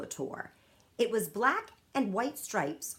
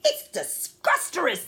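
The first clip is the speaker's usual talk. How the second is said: gurgling through food, louder; 6 semitones higher, 6 dB louder than usual. A narrator's reading. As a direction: gurgle it while chewing. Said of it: "the B F G gurgled".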